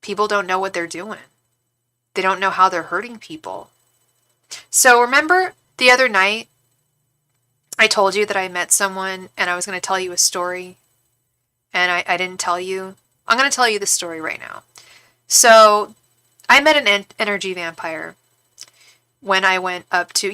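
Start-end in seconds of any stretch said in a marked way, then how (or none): none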